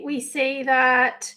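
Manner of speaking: ordinary talk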